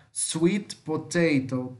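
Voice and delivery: slow Sweet potato, W like "oo"; Sweet potato modelled slowly with a U-like W. Shown as a demonstration. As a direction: stretch Sweet potato, starting "su-it". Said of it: "vai ter o som do, de U".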